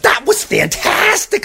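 high amazed princess praise; delighted gasp. Acted. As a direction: squeal it out in wonder. Elizabeth praising dragon.

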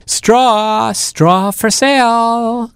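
high, slow hawker's call; seal crying wares. A narrator's reading. Seal selling straw to passing penguins, high slow sales cry. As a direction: call out the straw like a street seller. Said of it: "cried the seal".

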